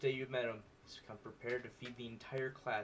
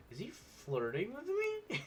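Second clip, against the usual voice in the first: surprised aside; 11 semitones higher, slower than usual. Real, not acted. Surprised by Sanders flirting.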